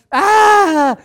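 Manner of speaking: a sharp, high mock cry of pain; acting out a shock of pain with one high cry; sudden cry of pain.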